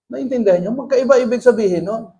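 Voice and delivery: ordinary talk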